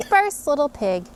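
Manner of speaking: ordinary delivery, nothing marked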